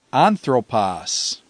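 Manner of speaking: anthropos slowly, stressed on AN; stress on antepenult. Shown as a demonstration. Teacher showing the acute, anthropos with stress on AN. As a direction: hit the first syllable of "anthropos", drawing it out. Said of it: "lies on the antepenult".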